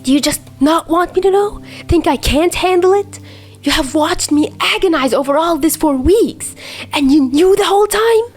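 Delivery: pressing him for answers he can't give; confused and hurt. A narrator's reading. Hurt friend firing questions at silent Dazai, confused and upset. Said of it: "he asked, confused".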